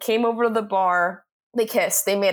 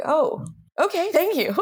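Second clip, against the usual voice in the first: higher, relieved acceptance of good news; acted pleasant surprise; 7 semitones higher than usual. Employee hearing unexpected praise from the boss, mock relieved surprise. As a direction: play pleasantly surprised, pitch lifted.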